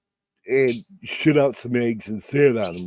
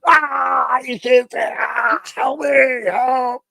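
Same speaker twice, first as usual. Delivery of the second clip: high, slow mock shout for help; hammed-up terrified shouting; panicked cry for help; 12 semitones higher, slower than usual. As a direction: yell as if a chicken is clawing your face. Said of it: "I got the chicken like this going".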